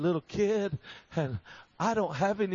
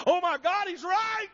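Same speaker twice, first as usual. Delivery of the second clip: high-pitched mock yell, not loud; imitated astonished outburst; 8 semitones higher than usual. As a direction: imitate a woman blurting it out in church. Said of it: "she yelled out, out loud".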